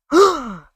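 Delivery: sharp high gasp into oh; startled gasp. Acted. Vanessa hearing the bee, high startled gasp. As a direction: gasp in shock.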